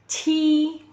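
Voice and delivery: "ci" as c with audible air, held long; aspirated Q sound. Shown as a demonstration. Teacher showing how Mandarin Q is read, aspirated. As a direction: let air rush through "ci". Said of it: "terus ada suara udara".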